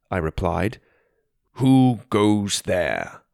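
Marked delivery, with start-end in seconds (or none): 1.5-3.2 very slow, drawn-out guard challenge; repeated slowly. Acted. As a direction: spell it out slowly for them. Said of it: "the guard repeated slowly".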